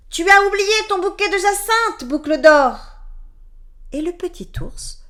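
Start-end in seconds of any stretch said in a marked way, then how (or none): 0.1-2.8 loud, higher calling voice; middle bear calling. A narrator's reading. Middle bear calling after Boucle d'Or, loud higher call. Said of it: "de sa voix moyenne".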